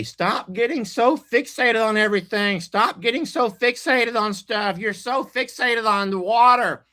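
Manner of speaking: playful high-pitched lecture; teasing scold. Retold or imitated. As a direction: nag them playfully about being fixated. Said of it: "I sometimes say".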